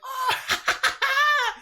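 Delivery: high-pitched mock maniacal cackle; imitated hysterical laughter. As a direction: reenact a wild, hysterical cackle. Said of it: "just laughing, like, hysterically".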